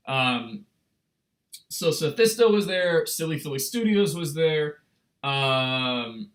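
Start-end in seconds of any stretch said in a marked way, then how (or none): none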